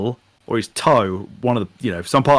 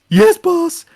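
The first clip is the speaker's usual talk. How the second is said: very high, eager little worker; high squeaky worker voice; 4 dB louder than usual. Pretend. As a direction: squeak it out like a nervous underling. Imagined worker answers boss.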